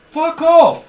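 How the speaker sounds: loud, high mock fuck off; annoyed yell at a quiz blurter; mock snap. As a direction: shout it at the show-off. Annoyed retort to quiz shouter.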